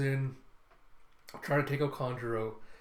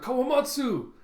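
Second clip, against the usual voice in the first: put-on high, loud child-in-danger cry; calling out; 11 semitones higher, 6 dB louder than usual. Captured Momonosuke calling for Kawamatsu, high imitated cry. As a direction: imitate a desperate call for rescue. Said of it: "as Momonosuke calls out".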